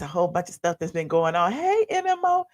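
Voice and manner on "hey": ordinary talk, nothing marked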